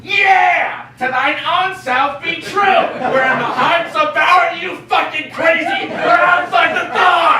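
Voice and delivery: exasperated stage shouting; indignant shouting. Acted. Arguing back about keeping within the law, indignant shouting. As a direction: shout it in comic outrage. Heated argument in a farce.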